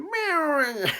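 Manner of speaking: a sudden high yelp of a cry; mock yelling cry. Small doctor Chopper yelling at his patient, a high yelp. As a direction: squeal it out high like a cartoon doctor. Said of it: "il est en train de gueuler".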